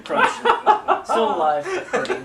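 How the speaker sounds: high, loud words through laughter; cracking up while you say it; laughing. Real, not acted. GM cracking up mid-narration.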